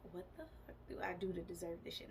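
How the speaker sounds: fast, breathless whisper to herself; whispering to herself, fast and raw; hushed despairing whisper. Retold or imitated. Left alone at the movies, she whispers despairingly.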